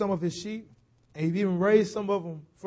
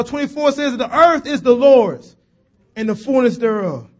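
ordinary talk, nothing marked

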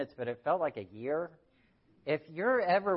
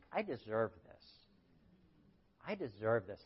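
soft, lower, self-justifying; understated entitlement; entitled mantra; 4 semitones lower, 8 dB quieter than usual. Retold or imitated. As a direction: murmur it like a claim.

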